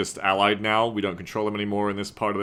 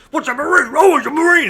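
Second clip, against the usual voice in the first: high, loud hammed-up Marine; gung-ho Marine voice; 9 dB louder than usual. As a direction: shout it like a moto Marine. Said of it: "the most fucking Moto Marine voice actor performance".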